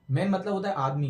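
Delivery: ordinary talk, nothing marked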